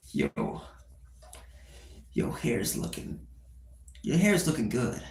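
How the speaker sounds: creepy whisper. Acted.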